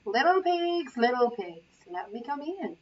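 plain reading voice

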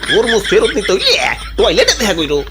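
put-on old grandfather. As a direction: speak like a crafty old dadu. Old man laughing taunt. (অনেক নিচু গলায় চালাক বুড়ো দাদু)

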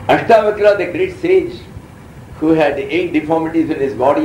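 ordinary talk

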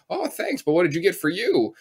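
put-on high, sweet old lady voice; a high sweet little old lady; elderly woman. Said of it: "this elderly woman was like".

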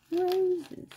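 roses sung up high; high cooing. Real, not acted. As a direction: coo it up high and sweet. Repeats word cooing high.

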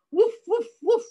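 high, loud little barks; dog woofing. Acted. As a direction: bark it out in three short bursts. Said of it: "what does a dog say".

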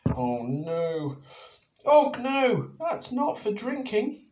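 high, loud alarmed cry; Penny cries out to Og, high and loud; cried out. Acted. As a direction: stop him at the fountain. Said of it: "cried Penny".

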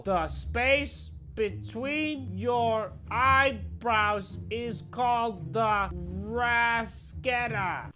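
quiz read-out. Acted. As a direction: draw out each word like a game announcer. Reading out a game's quiz statement.

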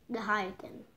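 soft muttered echo; quiet repeat. Shown as a demonstration. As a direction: repeat it under your breath. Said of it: "was the high again".

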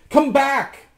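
high, loud call; shout. A narrator's reading. Rasmus climbs after his reflection, shouting. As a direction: shout it after someone fleeing. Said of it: "shouted Rasmus".

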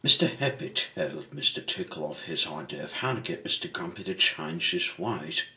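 plain reading voice

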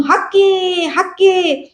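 high, drawn-out driving call; urging on bullocks. A narrator's reading. Balu bends over to goad his bullocks, urging call. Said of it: "असं बैलांना उचकत".

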